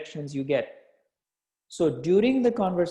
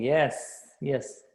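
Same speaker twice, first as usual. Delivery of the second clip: usual speaking voice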